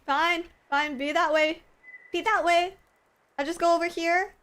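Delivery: jilted and huffy, hammed-up; mock huff. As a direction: storm off in a playful sulk. Mock huff at the picky fish.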